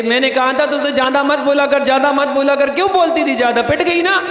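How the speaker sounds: ordinary talk, nothing marked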